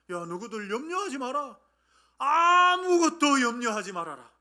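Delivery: high call building to a long shout; rousing exhortation. Acted. Paul exhorting the Philippian church, rousing shout.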